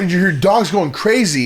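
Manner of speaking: ordinary talk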